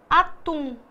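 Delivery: "Atum" with a strong final "tum", falling; stress on last syllable. Shown as a demonstration. Teacher demonstrating the oxytone to pupils, "Atum" stressed on "tum". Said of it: "tum é mais forte".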